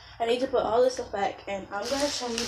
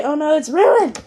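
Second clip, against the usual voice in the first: alarmed; 6 semitones higher, 10 dB louder than usual. Retold or imitated. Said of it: "I was gonna say".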